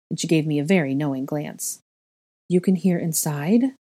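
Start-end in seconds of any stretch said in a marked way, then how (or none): none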